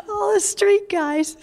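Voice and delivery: amused, laugh spilling over; real laughter. Real, not acted. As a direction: say it breaking into giggles. Laughing as she lists the crowd.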